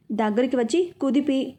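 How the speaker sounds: usual speaking voice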